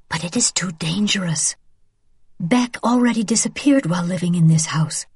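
hushed warning, voice dropped to a whisper. Acted. Mother whispers to daughter that killing the officer is too dangerous. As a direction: whisper it so no one overhears.

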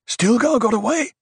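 high rising disbelieving question; surprised question. A narrator's reading. Question answered as statement.

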